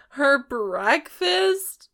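slow, loud, high disbelief; incredulous. Real, not acted. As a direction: drag it out, aghast. Incredulous echo.

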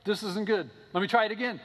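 hammed-up worried prophet; nervous retry. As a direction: fret and insist on another go. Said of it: "He's like".

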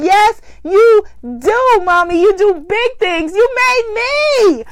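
tearful joy. Real, not acted. Tearful reply to her mom.

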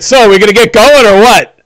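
big bolstering fellow, baffled and impatient; Don John. Acted. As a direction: boom it out, eager to leave. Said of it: "Don John is like".

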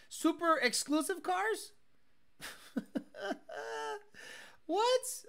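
high, amused disbelief; can't believe this filter; incredulous surprise. Real, not acted. Mocking the filter names.

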